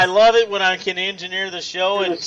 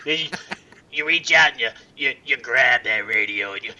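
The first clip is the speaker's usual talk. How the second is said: Wolfman Jack; 6 semitones lower than usual. Acted. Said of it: "he used to like to say".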